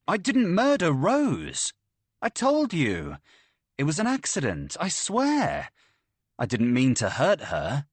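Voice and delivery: high, pleading denial; desperate plea. A narrator's reading. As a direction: beg her to believe it was an accident. Killer pleading it was accident.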